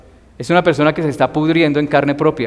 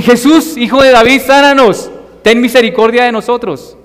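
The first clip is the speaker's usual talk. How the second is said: much higher, louder begging cry; desperate plea; 8 semitones higher, 6 dB louder than usual. Retold or imitated. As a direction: call out to Jesus for mercy. Lepers crying out to Jesus.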